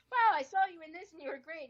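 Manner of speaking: high-pitched imitation of an admirer; mock gushing praise. Imitating people praising her work, mock gushing. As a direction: gush it like an impressed fan.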